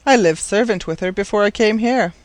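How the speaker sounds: plain reading voice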